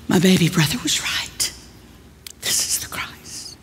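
whispered in shocked realization; hushed, trailing whisper of awe; awed whisper. Retold or imitated. Pete realizing his brother was right, whispering in awe. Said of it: "Pete realizes".

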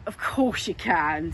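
low, slow fatherly indulgence; low, amused dad voice; smiling dad. A narrator's reading. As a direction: humour the child in a deep, unhurried voice. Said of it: "smiled his dad".

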